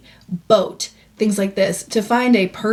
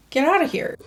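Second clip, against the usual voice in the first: playful dismissal; 8 semitones higher, faster than usual. Real, not acted. Teasing dismissal of the remark.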